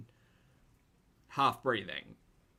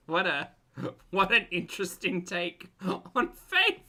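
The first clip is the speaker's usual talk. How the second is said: sarcastic; 6 semitones higher, 6 dB louder than usual. Real, not acted. Incredulous at interesting take.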